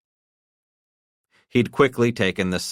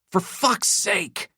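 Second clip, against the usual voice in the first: exasperated man; slower than usual. A narrator's reading. Bucky voiced, slow.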